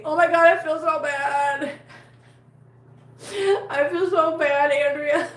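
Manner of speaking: high, loud, drawn-out apology; remorseful wail. Real, not acted. Streamer apologising to a viewer, remorseful wail. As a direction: exclaim it with guilty dismay. Apologising to Andrea for missing her.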